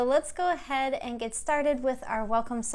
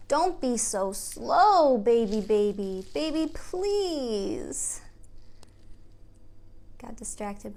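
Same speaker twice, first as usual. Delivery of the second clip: slow, sing-song picture book reading; reading to children; much slower than usual. Acted. Teacher reads a picture book aloud to the kids. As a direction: read it gently to little ones.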